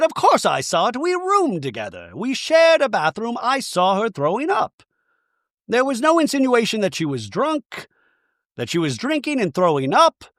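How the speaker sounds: high-pitched, exaggerated self-justifying voice; hammed-up high voice setting the record straight; defensive talking head. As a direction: protest in a high mock-defensive voice. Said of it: "Bronwyn in a talking head says".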